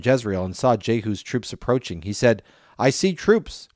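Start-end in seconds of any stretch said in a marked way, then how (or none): 2.8-3.7 high-pitched lookout's announcement; mild acted call, high but not shouted; watchman's call. A narrator's reading. Watchman calls down from tower.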